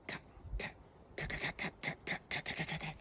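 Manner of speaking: soft staccato lip pops; popping cut notes. Shown as a demonstration. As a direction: pop the cuts quickly with the lips. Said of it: "You're making that popping sound".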